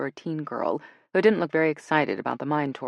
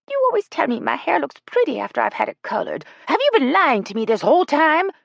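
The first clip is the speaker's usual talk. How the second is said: put-on much higher, louder offended old lady; affronted grandma, high and loud; Ella voiced; 12 semitones higher, 7 dB louder than usual. A narrator's reading. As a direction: squeak it out, shocked and wounded. Elderly Ella, hurt and indignant.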